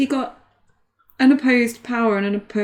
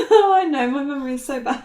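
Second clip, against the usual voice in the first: giggly, high-pitched admission; laughing; 4 semitones higher than usual. Real, not acted. Agreeing with a teasing friend, laughing. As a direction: say it through the tail of a laugh. Teased as terrible, laughs along.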